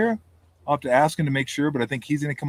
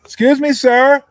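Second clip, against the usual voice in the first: much higher and louder, almost shouted; loud polite call; 13 semitones higher, 11 dB louder, slower than usual. Retold or imitated. Flight attendant shouting after Woody. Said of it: "And she goes".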